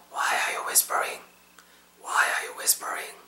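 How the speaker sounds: voiceless, slow demonstration whisper; hushed, fully whispered model sentence. Shown as a demonstration. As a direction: whisper the question to the learners. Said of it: "I was just whispering to you guys".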